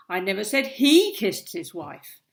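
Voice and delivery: single out he as the one who did it; stress on he. Shown as a demonstration. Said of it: "That is a great demonstration how, of how tone of voice completely changes a sentence".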